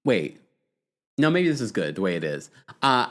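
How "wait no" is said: normal talking voice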